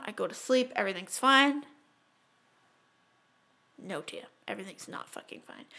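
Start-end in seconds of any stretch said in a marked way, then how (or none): 3.8-5.7 soft, unvoiced ominous line; whispered dread. Real, not acted. Telling scary story to cohost, whispered. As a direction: hush it for suspense. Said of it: "scary story turn".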